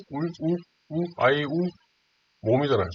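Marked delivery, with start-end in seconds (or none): 0.1-1.0 "울" cut back to a bare "우", higher and softer; vowel sound demo. Shown as a demonstration. Teacher proving it starts with a vowel, "우" isolated from "울". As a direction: strip "울" down to its vowel "우", repeated softly. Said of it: "이게 무슨 발음이죠".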